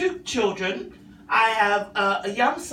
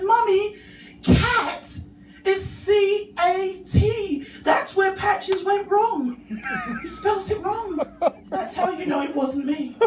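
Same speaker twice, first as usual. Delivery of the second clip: put-on much higher, slow spelled-out child logic; little boy voice; 9 semitones higher, slower than usual. Son proving to his mum the cat misspelled, high and slow. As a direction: spell it out like a proud toddler.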